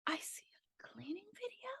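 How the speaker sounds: whisper. Retold or imitated. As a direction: whisper it like a secret thrill. Retelling morning surprise.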